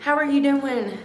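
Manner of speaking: high sing-song hello to April; cheery morning hello; cheerful greeting. Real, not acted. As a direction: greet her brightly. Greets pet or person.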